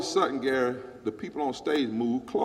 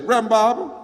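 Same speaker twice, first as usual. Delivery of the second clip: louder, higher calling the name; loud retold call to the reverend; 7 semitones higher, 10 dB louder, faster than usual. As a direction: call his name across the stage. Lady calls out to him.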